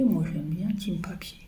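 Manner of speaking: quiet whispered confession. Real, not acted. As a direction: whisper your little preference. Confiding preference. (chuchoté, complice)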